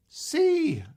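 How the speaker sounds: loud, high shout of a single letter; kids calling out. Acted. Kids shouting the answer to the magician, high call-out. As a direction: shout it out like an eager kid.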